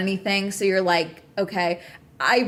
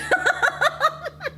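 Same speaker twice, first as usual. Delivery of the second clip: high real giggle; laugh. Real, not acted. Streamer laughing at being set off, high giggle.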